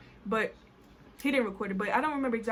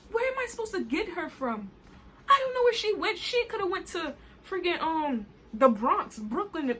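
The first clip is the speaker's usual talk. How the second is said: high-pitched, indignant protest; exasperated protest; 5 semitones higher than usual. Acted. Rider told to fetch her friend, exasperated. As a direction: protest in disbelief, pitch leaping up. Acted exasperated argument with driver.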